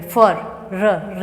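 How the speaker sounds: slow, rolled r at the end of फर; British r sounded. Shown as a demonstration. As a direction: stretch out फर, र and sound the र clearly. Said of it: "र को produce, प्रोनाउंसिएट यहाँ पे करते हैं". (फर में र को धीरे और साफ़ बोलना)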